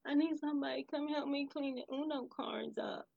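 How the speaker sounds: put-on high, softened little-kid plea; put-on pitiful child voice; sad child. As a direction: imitate a sulky child asking for help. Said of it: "she's looking all sad".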